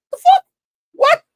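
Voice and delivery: high, loud squawk of disbelief; incredulous falsetto outburst; shocked disbelief. Retold or imitated. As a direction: yelp it out, re-enacting your shock. Retelling his shocked reaction.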